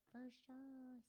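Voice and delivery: put-on airy Valley girl 'for sure'. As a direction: play a ditzy Valley girl. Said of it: "Sound like a Valley girl".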